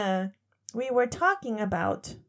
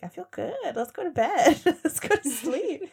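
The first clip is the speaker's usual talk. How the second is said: tipsy giggling; 5 semitones higher than usual. Retold or imitated. Tipsy self quoted.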